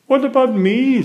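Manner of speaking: high, loud left-out question; Peppa pleading. A narrator's reading. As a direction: ask not to be forgotten. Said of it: "says Peppa".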